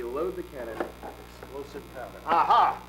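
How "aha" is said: loud discovery cry; triumphant aha. Acted. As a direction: announce the find loudly. Finds the powder.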